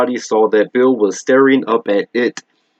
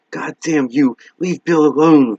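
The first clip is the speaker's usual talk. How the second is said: higher, half-held desperate shriek; 5 semitones higher than usual. Acted. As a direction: cry out in desperate defiance. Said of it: "he shrieked".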